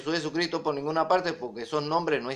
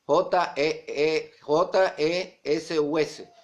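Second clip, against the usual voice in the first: normal talking voice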